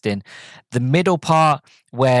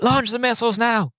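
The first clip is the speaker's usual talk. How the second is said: high-pitched panicked order, imitated; mock freak-out, pitch jumping right up; 12 semitones higher than usual. Mansley ordering a missile launch, imitated freak-out. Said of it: "he's freaking out".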